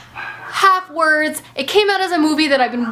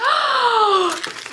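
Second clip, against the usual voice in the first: high, shouted gasp of delight; 7 semitones higher than usual. Real, not acted. Unwrapping next book.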